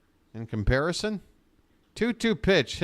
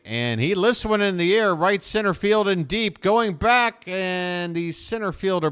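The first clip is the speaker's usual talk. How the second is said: excited call; 7 semitones higher, 4 dB louder than usual. Real, not acted. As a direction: voice climbing higher and louder as the ball carries. Home run call building.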